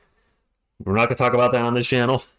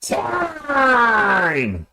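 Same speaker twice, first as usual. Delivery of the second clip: shouted, higher, drawn-out time; arena shout on time; wrestling announcer shout; 7 semitones higher than usual. Retold or imitated. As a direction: belt it like a ring announcer.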